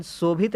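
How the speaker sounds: "शोभित" as "sobhit", plain hissing s first; wrong s sound. Shown as a demonstration. Teacher rejects the wrong form, "शोभित" mispronounced with s. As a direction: swap the sh of "शोभित" for s. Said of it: "नहीं होता है".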